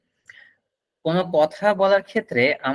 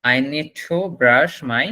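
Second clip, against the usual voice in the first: slow, careful teacher demo; slow model sentence; much slower than usual. Shown as a demonstration. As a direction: spell it out slowly for students. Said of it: "কথাটা বলুন".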